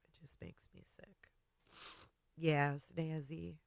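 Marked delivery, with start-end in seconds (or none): 0.0-1.1 whispered, much softer and lower; breathless whisper. Real, not acted. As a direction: let it fall to a tearful whisper. Same line repeated, then whispered.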